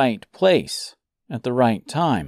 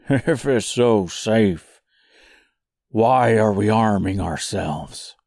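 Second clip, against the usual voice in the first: slow pumpkin man voice. Acted. Jack voiced in story.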